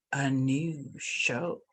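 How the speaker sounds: slow, drawn-out villain announcement; unhinged villain. Acted. Dramatic announcement, slowed.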